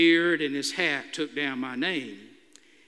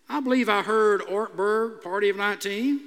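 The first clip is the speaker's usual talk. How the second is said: a hint of sheepish innocence, higher; nervous mock innocence; 3 semitones higher than usual. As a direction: play innocent, voice lifted.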